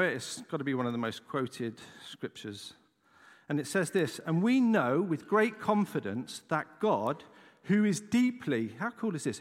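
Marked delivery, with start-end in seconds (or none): 4.3-8.7 slow, weighty reading; scripture reading. Acted. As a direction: lay each word down slowly. Reads scripture slowly.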